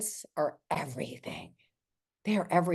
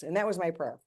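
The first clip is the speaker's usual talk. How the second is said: ordinary talk, nothing marked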